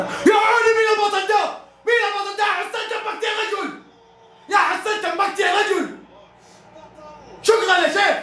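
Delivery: real excited fan shouts; thrilled shouting. Real, not acted. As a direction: cheer the defender to the sky. Cheering a great defensive play.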